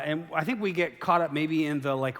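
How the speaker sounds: usual speaking voice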